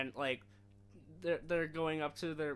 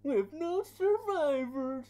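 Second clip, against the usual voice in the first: much higher, louder and very slow; slow hammed-up Bane; 12 semitones higher, 11 dB louder, much slower than usual. Friend quotes Bane crashing plane, slow high mock voice. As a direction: drag out the villain line.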